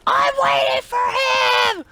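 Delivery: very high-pitched scream; screaming kid impression. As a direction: screech it like a furious little kid. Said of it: "he screams this loud. I don't know if I can do his voice".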